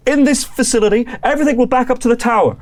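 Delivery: high, loud, fast, defensive; frantic NPC. Acted. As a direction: scientist explaining to the party. NPC answering player.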